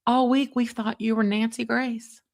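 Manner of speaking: high, amazed retold bailiff; mock the bailiff's astonishment; lightly voiced bailiff, amazed; imitating the bailiff. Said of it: "He's like".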